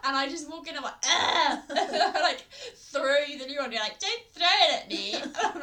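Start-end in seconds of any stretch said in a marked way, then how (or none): 1.1-1.6 loud, higher "Ugh!"; disgusted groan. Retold or imitated. Acts out disgust walking in. 4.0-5.1 loud, high, laughing imitation; indignant mock protest. Mimics sibling's indignant protest.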